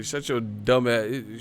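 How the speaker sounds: ordinary talk, nothing marked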